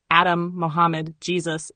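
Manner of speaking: usual speaking voice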